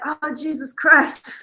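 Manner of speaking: slow, drawn-out exasperated groan; embarrassed groan. Retold or imitated. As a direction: brother cringing at the praise. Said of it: "And he was like".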